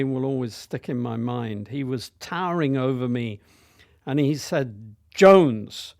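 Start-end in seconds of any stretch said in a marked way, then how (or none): 5.1-5.9 loud Welsh schoolmaster barking a surname; booming Welsh accent; Welsh teacher voice. Retold or imitated. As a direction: call out the name like a stern Welsh teacher. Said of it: "in his Welsh voice".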